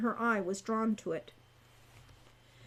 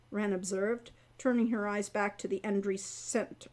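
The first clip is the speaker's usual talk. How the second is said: plain reading voice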